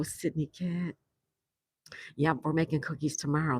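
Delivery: ordinary talk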